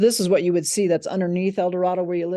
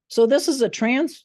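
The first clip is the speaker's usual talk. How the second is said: usual speaking voice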